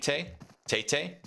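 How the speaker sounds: "Tay, Tate, Tay?" tried out with questioning rise; unsure name pronunciation. Real, not acted. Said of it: "Is that how you pronounce it".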